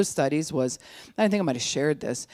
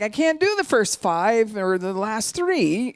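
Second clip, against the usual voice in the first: high, loud, drawn-out frustration; frustrated; 8 semitones higher, 5 dB louder, slower than usual. Acted. Partner walking off from a hard talk, frustrated. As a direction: protest it slowly, pitched right up. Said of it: "you walk off because you're like".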